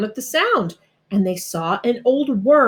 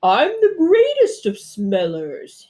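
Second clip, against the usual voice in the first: slow, louder proud boast; bragging bear; 5 semitones higher, 3 dB louder than usual. A narrator's reading. Bear bragging to the rabbit about his nose. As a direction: puff up with pride. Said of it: "he bragged".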